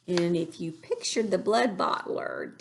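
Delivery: plain reading voice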